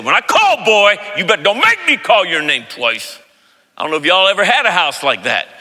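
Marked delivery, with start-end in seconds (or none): 0.0-3.2 higher, raised father's warning; imitating dad's scolding; stern dad. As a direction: lay down the law like dad. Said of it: "And he said".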